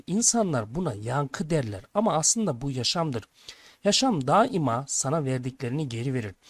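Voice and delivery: ordinary delivery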